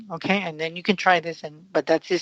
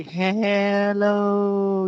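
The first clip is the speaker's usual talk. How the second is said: a wavering "Hello," as if spoken into a spinning fan, pitched up; fan echo voice; 4 semitones higher than usual. Retold or imitated. Recalling kids playing with the fan, an echo-like hello. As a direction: call "Hello," into the fan like a kid hearing the echo. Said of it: "get in front of the fan and say".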